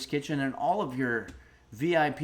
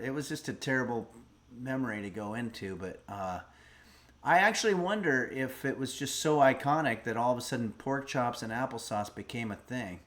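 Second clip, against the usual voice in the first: ordinary talk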